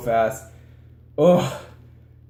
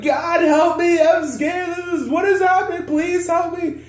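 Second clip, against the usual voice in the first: very high, loud, desperate cry for help; retelling the flood crossing, a shrieking prayer; panicked prayer; 4 dB louder than usual. Retold or imitated. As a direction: beg God in a squealing panic. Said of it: "here I am freaking, I'm like".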